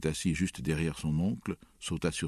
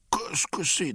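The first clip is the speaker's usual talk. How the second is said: high, menacing demand; furious, high-pitched challenge; terrible voice; 12 semitones higher, 4 dB louder, slower than usual. A narrator's reading. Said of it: "d'une voix terrible".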